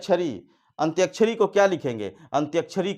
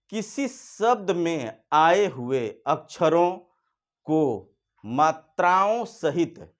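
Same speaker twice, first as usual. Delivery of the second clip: slow dictation; 2 semitones higher, slower than usual. Shown as a demonstration. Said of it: "फिर सुनिए".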